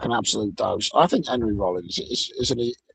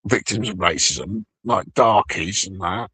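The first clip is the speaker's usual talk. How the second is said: ordinary delivery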